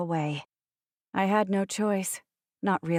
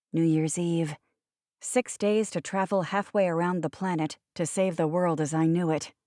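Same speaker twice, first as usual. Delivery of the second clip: ordinary delivery, nothing marked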